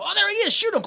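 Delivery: high-pitched cartoonish alarm; mock alarmed cry. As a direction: cry out as if spotting the monster. Acting out police spotting the beast.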